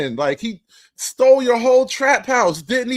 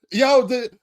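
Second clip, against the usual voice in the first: ordinary talk, nothing marked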